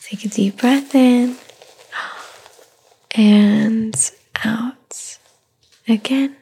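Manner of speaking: slow whispered breathing cues; guided breathing whisper. Acted. Mother guiding the baby's breaths in a whisper. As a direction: draw the breath in and out with your whisper.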